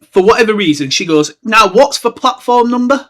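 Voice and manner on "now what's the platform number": higher, slow fussing mother; a high-pitched caring mum, asking slowly; motherly Molly voice. A narrator's reading. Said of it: "said the boy's mother".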